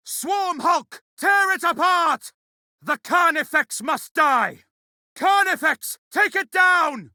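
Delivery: high, forceful battle shout; shouted battle order. Acted. Warrior ordering the squad to take down a Carnifex, high urgent shout. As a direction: yell the attack order, pitched well up.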